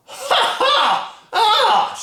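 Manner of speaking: shrill witchy cackle, very high; triumphant cackle. Acted. Grandma catching George, shrill triumphant cackle. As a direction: cackle as you catch him out. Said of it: "she cried".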